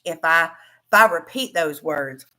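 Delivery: usual speaking voice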